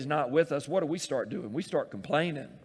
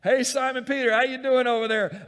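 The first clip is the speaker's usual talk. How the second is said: loud, higher, playful taunting call across the water; teasing Jesus; 9 semitones higher, 8 dB louder than usual. Acted. Jesus teasing his disciples in the storm, loud playful call. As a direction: call out cheekily to the struggling fishermen.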